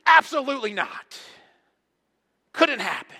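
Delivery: loud, high-pitched acted refusal; hammed-up emphatic outburst; emphatic denial. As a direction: fire it out as a forceful no. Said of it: "this is so emphatic".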